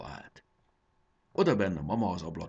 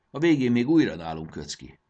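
ordinary delivery, nothing marked

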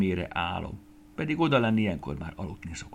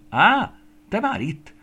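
high, raised exclamation; surprised greeting; 13 semitones higher, 9 dB louder, slower than usual. A narrator's reading. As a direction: look up and exclaim, pitched high. Queen looks up at returning Estike. (meglepett, magas hangú felkiáltás)

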